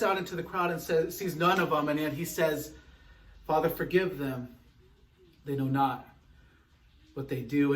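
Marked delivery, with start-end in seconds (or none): 3.5-7.7 soft plea. Acted. Jesus's words, slow and soft.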